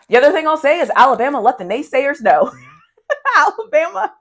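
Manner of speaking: giggling through the words, pitch jumping up; can't hold back the laugh on Alabama. Real, not acted. Host cracking herself up over Alabama, laughing. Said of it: "Sorry, that tickled me".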